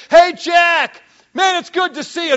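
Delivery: calling out greeting. Retold or imitated. Friend hailing Jack in a busy airport, cheery shout. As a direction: call across the airport to a friend.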